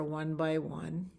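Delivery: ordinary delivery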